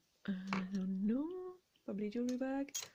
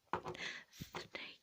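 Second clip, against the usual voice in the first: whisper; 14 dB quieter than usual. Real, not acted. Naming a ring softly.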